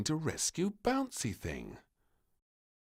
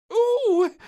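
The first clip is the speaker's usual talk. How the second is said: very high, loud delighted squeal; a little girl squealing at her dinner; high excited squeal; 18 dB louder than usual. A narrator's reading. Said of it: "squealed the little princess".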